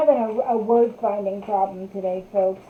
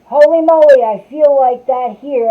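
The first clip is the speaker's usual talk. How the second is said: holy moly burst out much louder; an amazed outburst; surprised exclamation; 3 semitones higher, 11 dB louder than usual. Real, not acted.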